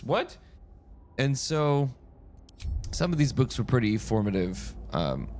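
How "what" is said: sharp high rising disbelief; can't believe it's allowed; high squeak of disbelief; incredulous. Retold or imitated. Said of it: "was like".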